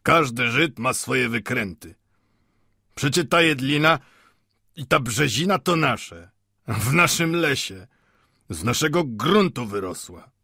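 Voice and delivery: lightly voiced smug townsman; self-assured watchmaker. A narrator's reading. Watchmaker claims the forest as ours, self-assured. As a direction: assert it firmly, in a slightly altered voice.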